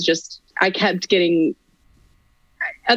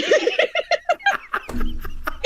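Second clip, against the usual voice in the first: real high-pitched laughing fit; helpless laughter; 3 dB quieter than usual. Real, not acted. Actor laughing at her own Irish accent attempt, high and helpless. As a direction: crack up at yourself, pitched high.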